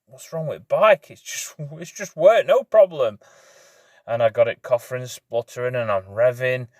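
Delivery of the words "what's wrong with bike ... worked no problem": higher, slowed disbelief; replaying his own panic, pitched up; retold panic. Biker recalling his panic when the bike died, pitched up. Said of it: "I'm like".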